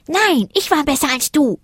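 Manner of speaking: high, insistent retort. A narrator's reading. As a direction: squabble back like a little rival. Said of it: "entgegnete Zack".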